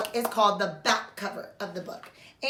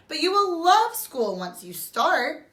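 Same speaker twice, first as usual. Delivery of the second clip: reassuring mother; 5 semitones higher than usual. Acted.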